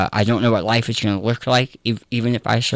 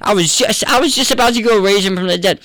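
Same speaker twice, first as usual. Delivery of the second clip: much higher, hammed-up exasperated voice; exasperated Jesus; 9 semitones higher than usual. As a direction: play Jesus brushing her off, comically impatient.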